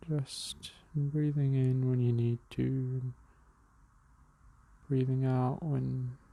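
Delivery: calm, unhurried meditation guide with long pauses; even, measured breathing-exercise voice; guided meditation. Real, not acted.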